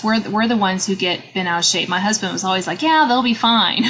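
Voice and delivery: usual speaking voice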